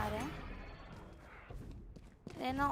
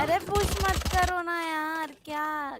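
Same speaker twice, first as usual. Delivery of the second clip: exasperated, louder; frustrated shout; 3 semitones higher, 5 dB louder, slower than usual. Real, not acted. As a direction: scold teammates for pushing. Frustrated at teammates pushing.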